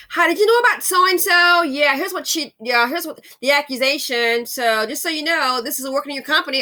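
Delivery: loud, high-pitched acted caller; acted loud quote. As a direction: shout it out like an eager tipster. Acted call to expose people.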